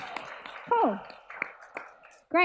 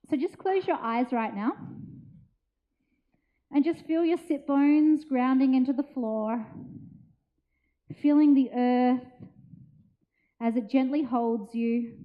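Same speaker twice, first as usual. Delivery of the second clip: guided meditation; 3 semitones lower than usual. Acted. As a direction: ease them gently into stillness. Leads a meditation.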